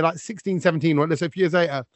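ordinary talk

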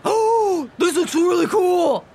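high, keen doggy exclamation; excited Omen. Acted. Omen looks at the Five Below, high-pitched excitement. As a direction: gush over an exciting find.

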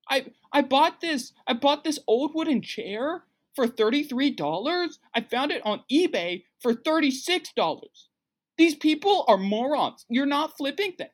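high-pitched, bragging flipper impression; Gary V. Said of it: "that's my Gary Vee impression".

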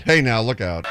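ordinary delivery

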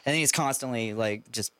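ordinary delivery

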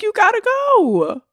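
high, drawn-out mock send-off; slow, high-pitched acted dismissal; emphatic dismissal. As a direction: stretch it out high, shooing them away.

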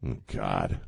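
normal talking voice

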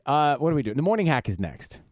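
usual speaking voice

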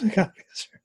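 cracking up mid-line; losing it to giggles; breaking into laughter. Real, not acted.

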